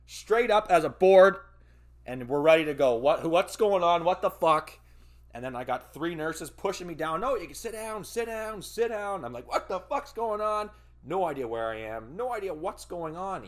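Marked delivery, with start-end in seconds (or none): 2.9-4.8 loud, high, disoriented shouting; confused loud yell. Retold or imitated. Waking confused after surgery. 7.2-9.3 much higher, insistent "sit down"; imitating the nurses. Nurses pushing him down. 9.5-10.7 higher, louder, bewildered shout; confused outburst. Retold or imitated.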